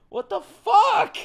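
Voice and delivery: high, slow, near-tears outburst; choked-up disbelief; overwhelmed cry. Real, not acted. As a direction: break as the emotion hits. Moved to tears by scene.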